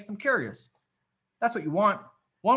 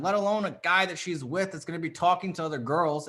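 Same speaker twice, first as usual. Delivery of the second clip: ordinary delivery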